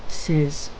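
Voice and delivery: plain reading voice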